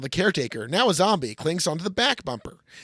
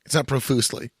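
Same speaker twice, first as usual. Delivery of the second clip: ordinary talk, nothing marked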